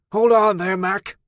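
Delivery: put-on loud, high old man's yell; old man yells a warning at the approaching stranger. A narrator's reading. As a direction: bellow a warning across the clearing. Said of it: "The old man yelled".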